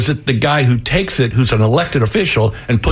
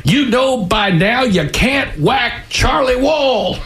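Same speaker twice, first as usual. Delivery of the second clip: very high, drawn-out taunting call; Charlie Wall; 12 semitones higher, much slower than usual. A narrator's reading. Old boss to his would-be assassin through the door, taunting shout. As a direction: holler it slowly in a high Southern character voice. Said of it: "he shouted to his would-be assassin".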